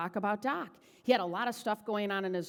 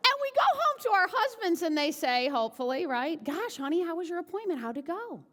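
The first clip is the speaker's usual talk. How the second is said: very high mock-sweet husband voice; husband asking; 6 semitones higher than usual. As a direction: play the doting husband, pitched way up. Wife mimics husband asking sweetly.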